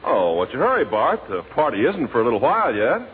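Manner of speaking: usual speaking voice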